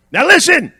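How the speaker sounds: high, loud preacher's call; punchy pulpit shout; calling for attention. Real, not acted. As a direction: command the room to listen. Preacher calls congregation to attention.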